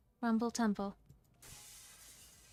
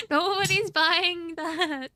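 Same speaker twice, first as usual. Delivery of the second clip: scoffing retort; 8 semitones higher, 9 dB louder, slower than usual. Acted. Character scoffs at tour bus lie.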